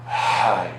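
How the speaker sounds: Hai voiced loud, not whispered. Shown as a demonstration. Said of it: "you use your voice".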